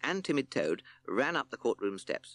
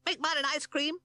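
squeaky little bat, much higher. Acted. Batty Bat pipes up to the friends, high and squeaky. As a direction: chirp it like a tiny bat.

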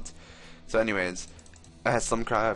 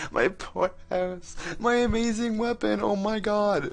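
much higher, louder wailing over losses; mock lament; 12 semitones higher, 4 dB louder, slower than usual. Real, not acted. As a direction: wail over the lost sword. Lamenting death and lost items.

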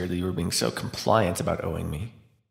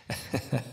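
soft embarrassed breath laugh; 7 dB quieter than usual. Acted.

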